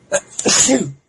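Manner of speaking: real sudden sneeze; a loud sneeze out of nowhere. Real, not acted. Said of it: "I'm gonna sneeze".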